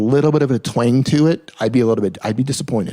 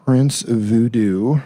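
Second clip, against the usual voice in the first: "Prince Voodoo" very slow, each syllable stretched; "Prince Voodoo" dragged out, left hanging on a rise; sounding out slowly; much slower than usual. Real, not acted. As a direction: draw out "Prince Voodoo" as if typing it. Types the name a second time.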